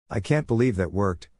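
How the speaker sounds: usual speaking voice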